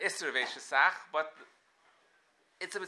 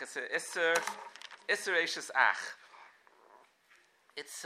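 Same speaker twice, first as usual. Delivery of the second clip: ordinary talk, nothing marked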